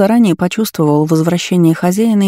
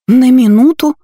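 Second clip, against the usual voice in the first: higher, louder outraged retort; indignant; 8 semitones higher, 5 dB louder than usual. A narrator's reading. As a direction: fling the words back at him. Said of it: "возмущённо прервала Лола".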